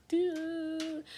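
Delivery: a blank numb doo; flat doo sound. Retold or imitated. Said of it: "It was like".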